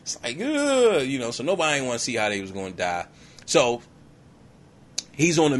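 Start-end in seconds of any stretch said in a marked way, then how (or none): 0.4-1.0 high, drawn-out mock "ugh"; disgusted groan. Soldiers refusing to see their own deaths, groaning in disgust.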